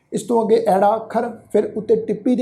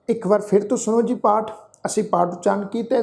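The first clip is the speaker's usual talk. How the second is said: ordinary talk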